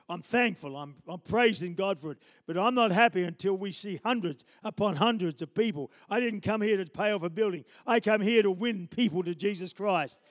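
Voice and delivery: loud, insistent pulpit delivery; impassioned preaching. Real, not acted. Preacher declaring his hunger to win souls, impassioned preaching. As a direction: press each phrase home with force.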